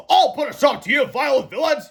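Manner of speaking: high, loud brave declaration; heroic boast. A narrator's reading. Captain Underpants facing the toilets, heroic loud boast. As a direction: proclaim it like a superhero. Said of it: "he said fearlessly".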